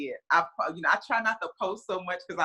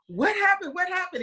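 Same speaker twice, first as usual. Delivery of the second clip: much higher, breathless questioning; urgent surprise; 13 semitones higher than usual. Retold or imitated. Mom asking her friend on the phone, high, urgent surprise. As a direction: demand to know, pitch shooting up. Friend calls after the game.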